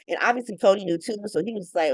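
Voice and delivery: ordinary talk, nothing marked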